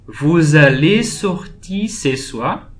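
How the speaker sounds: slow model question, "soir" lifted at the end; rising question intonation. Shown as a demonstration. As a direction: turn the statement into a question with a rise on "soir". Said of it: "tem uma diferença de entonação".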